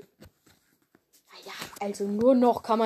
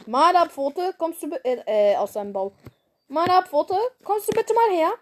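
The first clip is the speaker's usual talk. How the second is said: calling out; 7 semitones higher, 4 dB louder than usual. Acted.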